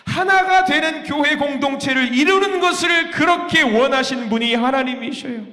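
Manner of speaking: fervent preaching cry. Real, not acted. Preacher cries out on unity.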